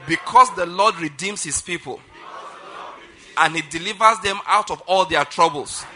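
loud, measured proclamation with pauses; preaching declaration. Acted. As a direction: declare it line by line for a congregation to repeat. Leads congregation in declaration.

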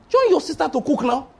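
high, pushy coaxing; pushy tempter. Acted. Satan pushing Mary to help her sister, high and pushy. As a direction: nag her into the kitchen.

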